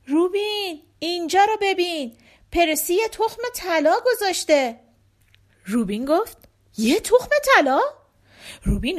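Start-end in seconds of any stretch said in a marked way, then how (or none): 0.1-4.8 high, excited call to a friend. Acted. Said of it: "نلی اونو صدا زد". 6.8-7.8 high, louder astonished echo; amazed question. Acted. Hears of golden egg, amazed.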